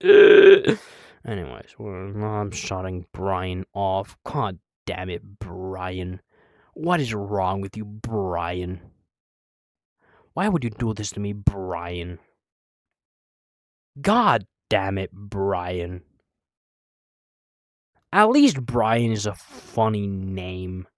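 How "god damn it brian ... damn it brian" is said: low, subdued mock scolding; low-pitched, playful reproach; mock exasperation. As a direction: scold Brian wearily in a low voice. Mock scolding of a crashed Brian.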